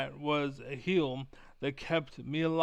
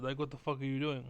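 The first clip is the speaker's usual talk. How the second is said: usual speaking voice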